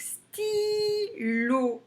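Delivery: stylo in two long, high beats, sty, lo; very slow, chanted sty-lo; tapped out syllables. Shown as a demonstration. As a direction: hold the i in stylo. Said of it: "on tape stylo".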